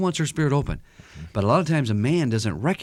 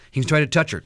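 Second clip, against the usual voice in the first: usual speaking voice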